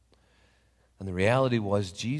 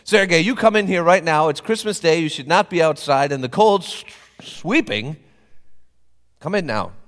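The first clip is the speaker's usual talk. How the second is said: loud, higher, kindly bossy; insistent invitation; 8 semitones higher, 11 dB louder than usual. Acted. Papa ordering Sergei in from the cold, loud and kindly bossy. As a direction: insist he comes in.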